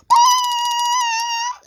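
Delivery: very high shrieking whistle sound; whistle scream. Retold or imitated. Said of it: "Edward blows his whistle like".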